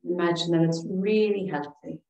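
calm, soothing relaxation voice with long pauses; guided meditation. Real, not acted. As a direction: guide them gently through each breath, leaving space. Leads breathing and meditation.

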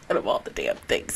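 ordinary talk

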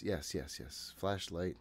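ordinary delivery

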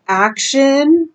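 normal talking voice

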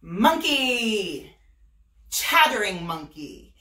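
loud, slow storybook announcement; grand read-aloud opening. A narrator's reading. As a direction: announce the title character like a storyteller. Story opening, louder, slower.

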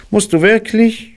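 very high, courteous rabbit voice; high-voiced polite question. A narrator's reading. Rabbit asks departing guest politely, high voice. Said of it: "sagte Kaninchen höflich".

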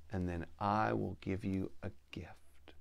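soft whispered offer; whispered promise. Acted. Mountain lion tempting the girl, whispered offer. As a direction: breathe the promise of a gift.

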